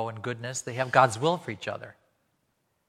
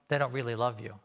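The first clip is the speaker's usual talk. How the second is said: ordinary delivery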